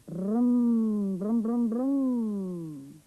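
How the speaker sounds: loud brum brum engine sound; car engine noise. A narrator's reading. Car coming on her left, loud engine brum. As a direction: rumble like a passing car. Narrator imitates passing car.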